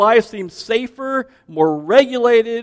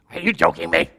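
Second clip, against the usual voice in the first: Kevin from The Office; 13 semitones lower, 6 dB quieter, faster than usual. Retold or imitated. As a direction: drop way down like a dopey Kevin. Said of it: "He sounds like Kevin from The Office".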